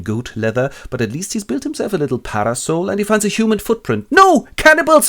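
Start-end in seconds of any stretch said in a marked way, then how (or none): 4.1-4.5 high, loud panicked shout; comic panic. Acted. Robinson panicking at a human footprint, high and loud. 4.6-5.1 high, loud shriek of cannibals; a terrified shout; panicked cry. Acted. Robinson seeing the footprint, shrieking in fear.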